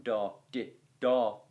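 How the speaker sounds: sung out as long short long beats, da, di, da; da di da rhythm. Shown as a demonstration. Teacher chanting the rhythm for students, beat by beat. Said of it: "It couldn't have gone".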